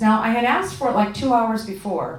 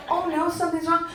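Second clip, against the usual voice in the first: high mocking echo of it; 7 semitones higher than usual. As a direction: repeat it back incredulous and higher.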